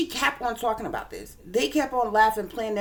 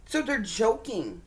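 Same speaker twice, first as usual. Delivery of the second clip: ordinary talk, nothing marked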